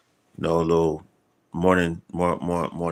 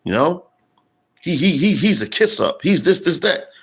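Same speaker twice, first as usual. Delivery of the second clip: imitated coworker; 9 semitones higher than usual. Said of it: "He said".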